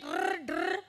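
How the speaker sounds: "rrr, drrr" with rolled tongue; trilled R. Shown as a demonstration. Teacher checks nobody got the wrong sound, "rrr, drrr" trilled. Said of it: "It is not drrr, it is not rrr".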